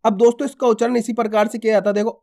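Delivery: ordinary talk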